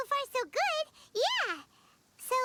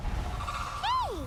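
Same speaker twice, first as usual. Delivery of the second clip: calling out; 11 semitones higher than usual. Acted. Spotting Cloud Retainer, calling out.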